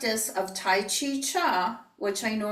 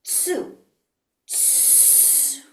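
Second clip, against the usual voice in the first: voiceless breathy tsu; exhaled whispered tsu; whispered huff; 5 semitones higher, 4 dB quieter than usual. Shown as a demonstration. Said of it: "So I'm gonna go".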